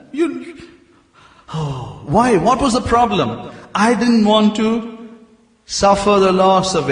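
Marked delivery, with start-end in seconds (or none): none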